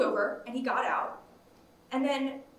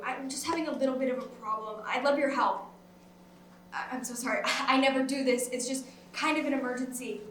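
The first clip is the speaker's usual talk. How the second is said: ordinary talk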